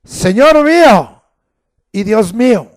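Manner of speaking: loud high outcry; Thomas's loud amazed confession; exclaimed. Retold or imitated. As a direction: shout it out in wonder. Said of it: "Tomás respondió y le dijo".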